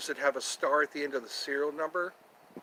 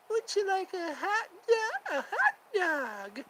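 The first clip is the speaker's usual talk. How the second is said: high, squeaky sketch voice offering a hot dog; Emma Stone hot dog skit. Retold or imitated. Streamer imitating the Emma Stone SNL skit, high and squeaky. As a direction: pitch way up and drawn out, like the SNL bit. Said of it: "Wasn't that Emma Stone?".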